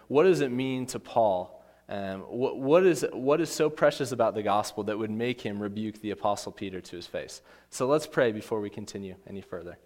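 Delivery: ordinary delivery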